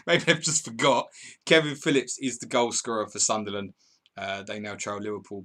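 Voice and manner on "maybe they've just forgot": laughing. Real, not acted. Laughing at the missing assist.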